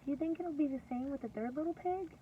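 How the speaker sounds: ordinary talk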